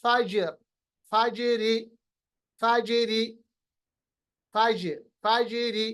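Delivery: फाजिरी with a bounce on ज, said फ़ज्रि; qalqalah demo. Shown as a demonstration. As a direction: echo the ज in each फाजिर. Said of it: "इसी तरह". (फाजिरी में जीम की गूँज)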